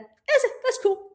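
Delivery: very high, delighted cheer; triumphant. Retold or imitated. After falling while skiing, high delighted cheer. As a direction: cheer yourself back up. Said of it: "I got up and went".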